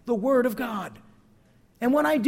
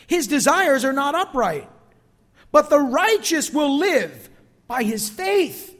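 emphatic scripture reading; 4 semitones higher, 6 dB louder than usual. Acted. Scripture read with force.

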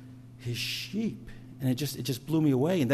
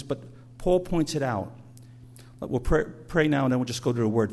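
usual speaking voice

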